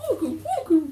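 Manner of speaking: high laughing pet talk. Real, not acted. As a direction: cheer the dog on in pet voice. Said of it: "Baby voice and pet voice is the same voice".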